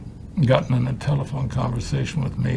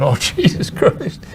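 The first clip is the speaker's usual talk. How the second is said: laughing Marty; 7 semitones higher, slower than usual. Retold or imitated. Marty reacts to profane birthday speech.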